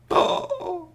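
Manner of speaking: very high drawn "oh"; high-pitched crowd "ohh"; astonished gasp. Acted. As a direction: gasp in amazement. Everyone was astonished.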